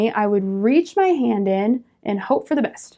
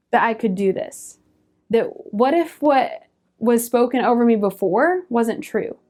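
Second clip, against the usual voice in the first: ordinary talk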